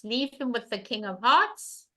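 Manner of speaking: normal talking voice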